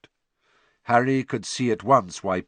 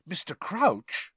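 higher, drawn-out awe; writhing with excitement; 9 semitones higher, slower than usual. A narrator's reading. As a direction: gush the name, pitched up high. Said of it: "positively writhing with excitement".

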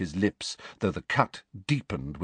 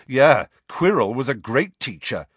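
raised-voice, higher defiant retort; loud; 5 semitones higher, 6 dB louder than usual. A narrator's reading. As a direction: answer back loudly and boldly. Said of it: "said Harry loudly".

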